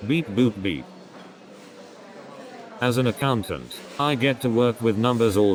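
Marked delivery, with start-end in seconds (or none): 0.1-0.9 flat robotic beeping. Pretend. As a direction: talk like a little robot. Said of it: "my accounting job is turning me into a robot".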